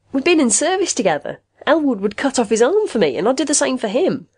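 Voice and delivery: high-pitched, heated protest; indignant denial. A narrator's reading. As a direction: defend your best friend against a slander. Friend indignantly denies a murder accusation.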